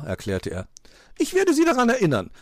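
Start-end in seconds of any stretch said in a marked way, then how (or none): 1.2-2.3 quick, high, loud tease; amused magpie. A narrator's reading. Magpie teases fox about snow, amused. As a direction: toss it out fast and gleefully. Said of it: "lachte Frau Elster".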